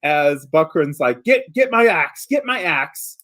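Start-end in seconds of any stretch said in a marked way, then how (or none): 1.2-3.2 higher-pitched, urgent panicked call. Acted. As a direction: call for it in mounting alarm. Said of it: "you hear panic inside".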